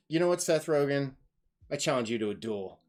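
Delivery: mock challenge. Mock duel challenge between YouTubers.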